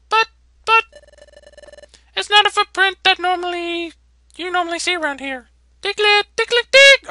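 very high, loud squeaky little mole voice; flustered Diglett. Acted. Sentry Diglett flustered about the footprint, very high squeaky panicked voice.

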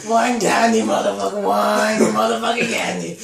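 loud triumphant taunt; gloating. Real, not acted. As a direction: rub in the victory. Gloating after the win.

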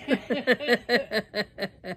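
sheepish chuckle at yourself; embarrassed laugh. Real, not acted. Laughs at near-choking.

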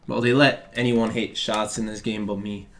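ordinary talk, nothing marked

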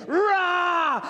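high drawn-out roaring yell; lion roar shout. Acted. Preacher voicing the beast's roar at the sheep lion, long shout. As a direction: roar it like a lion across the river. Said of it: "this beast goes".

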